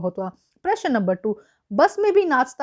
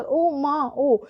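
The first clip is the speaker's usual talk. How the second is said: ordinary talk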